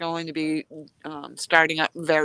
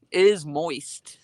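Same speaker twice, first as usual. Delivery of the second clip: disgusted at the word; 2 semitones lower, 4 dB louder, slower than usual. Shown as a demonstration. Telling stream viewers she hates the word, disgusted. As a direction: drag out moist with distaste, slowly. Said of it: "And I hate that word".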